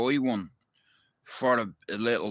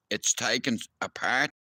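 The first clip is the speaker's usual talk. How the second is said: ordinary talk, nothing marked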